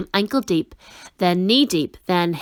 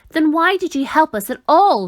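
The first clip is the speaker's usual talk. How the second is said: higher, louder angry question; angry shout; 6 semitones higher, 4 dB louder than usual. A narrator's reading. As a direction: demand it in anger. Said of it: "shouted Milo angrily".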